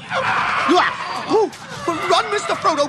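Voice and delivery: yelling high and loud in sudden alarm; panicked warning cry to Frodo; alarmed shout. Acted. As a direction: scream at him to run. Sam yells a warning to flee.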